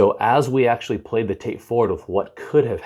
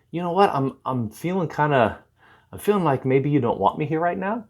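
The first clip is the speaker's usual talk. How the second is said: higher-pitched, tentative sharing of a feeling; 7 semitones higher than usual. Shown as a demonstration. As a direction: feel your way into it, pitched up, ending on a rise. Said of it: "I call this external processing".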